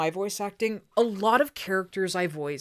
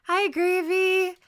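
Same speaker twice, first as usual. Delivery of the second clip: high greeting; 9 semitones higher, 3 dB louder, slower than usual. Acted. Greeting chat viewer playfully.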